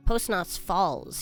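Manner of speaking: ordinary talk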